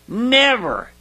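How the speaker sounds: "never" pitched high, falling away; emphatic never. Real, not acted. Preacher underlining what the Spirit does, emphatic high "never". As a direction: set "never" apart and lift it high. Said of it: "Underline that".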